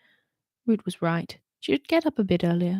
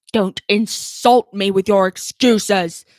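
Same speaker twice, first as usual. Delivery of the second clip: louder, slow angry roar; 8 dB louder, slower than usual. Acted. Root roaring at Holly's excuses, loud and slow. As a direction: roar it down at her. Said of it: "roared the commander".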